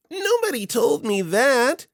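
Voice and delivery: high, slow nasal whine. Retold or imitated. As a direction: whine it like a petty boss. Plops voiced in anecdote.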